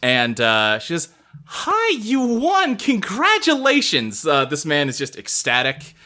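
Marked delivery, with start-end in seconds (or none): 1.5-4.3 high, sugary forced-smile voice; fake cheerful greeting. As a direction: greet him through a plastered smile. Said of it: "extremely artificial smile".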